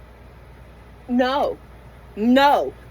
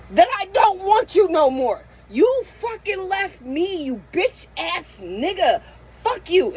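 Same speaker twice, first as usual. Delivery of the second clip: angry; 7 semitones higher than usual. Real, not acted. Cursing the absent husband.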